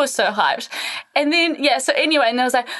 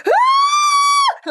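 very high, piercing mock scream; imitated scream; 4 dB louder than usual. Massage therapist screaming mid-session, high imitated scream.

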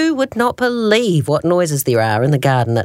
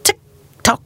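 clock ticking; 7 semitones higher than usual. Acted. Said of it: "went the living room clock".

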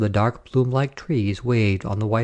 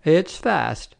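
blue-eyed soldier; 6 semitones higher than usual. A narrator's reading. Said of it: "said the blue-eyed soldier".

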